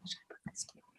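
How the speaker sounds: the same question repeated in a soft unvoiced whisper. Real, not acted. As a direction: ask it again, whispered off-mic.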